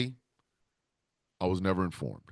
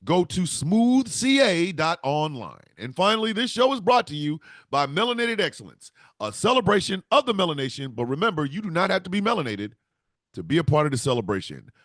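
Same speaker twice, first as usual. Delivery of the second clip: upbeat sponsor read, higher and louder; 8 semitones higher, 4 dB louder than usual. Acted. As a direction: sell it like a radio spot, bright and punchy.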